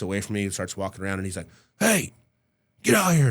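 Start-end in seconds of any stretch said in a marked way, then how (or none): 1.8-3.3 high, raised summoning call. Retold or imitated. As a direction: imitate hollering someone out of a room. Imitates man calling into apartment.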